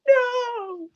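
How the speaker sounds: high gasped no; mock gasp. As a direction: gasp then a horrified no. Playful horror at the news.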